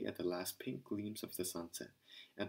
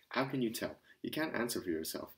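ordinary delivery

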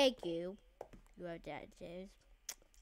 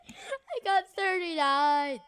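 mock wail; 8 semitones higher, 8 dB louder than usual. Losing the game.

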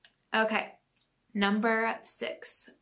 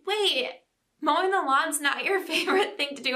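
shocked; 6 semitones higher than usual. Retold or imitated. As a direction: replay the kid's hurt surprise, pitched up. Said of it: "so shocked".

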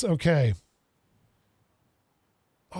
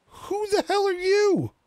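much higher and slow, genuinely thrown; startled disbelief; 12 semitones higher, slower than usual. Real, not acted. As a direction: blurt it out, caught off guard by the stranger. Startled by a stranger in shop.

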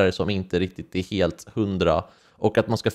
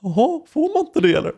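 much higher, mock-innocent question; mock surprise; 13 semitones higher than usual. Boy answering classmate about his sweater, high mock surprise. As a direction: play it offended and caught off guard. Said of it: "Och jag bara".

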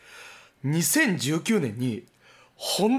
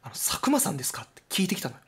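unvoiced, softer whispered question; turtle whisper; 4 dB quieter, faster than usual. The rescued turtle asks Sakuma his name, whispering. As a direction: ask it in a secretive whisper. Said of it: "そしたらその亀が".

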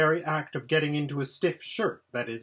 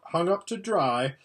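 ordinary delivery

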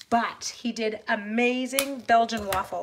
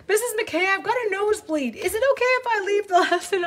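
put-on high-pitched, louder kid asking the teacher; put-on pupil voice, pitched way up; imitated pupil; 10 semitones higher, 6 dB louder than usual. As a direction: mimic a student pleading to be excused. Retelling a pupil's urgent request.

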